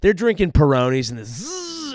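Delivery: ordinary delivery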